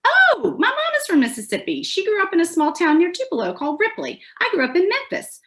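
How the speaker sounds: high, excited Southern gush; delighted to find a shared home state; gushing recognition. Acted. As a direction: squeal the "Oh", then chatter on high. Excitedly claiming kinship with Southern tourists.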